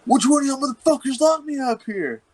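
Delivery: hammed-up imitation of an outraged friend; mock furious demand. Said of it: "And he was like".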